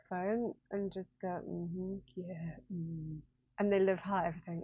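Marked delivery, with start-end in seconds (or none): 1.5-3.2 put-on Southern American accent. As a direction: imitate an agreeable Southern American couple, hushed. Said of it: "Southern American voices".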